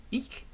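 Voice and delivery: tiny high eek of surprise; a short high squeal when startled. Acted. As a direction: squeak in fright.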